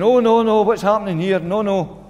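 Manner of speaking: mock panic. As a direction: act out a panicking disciple in the boat. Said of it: "screaming in terror".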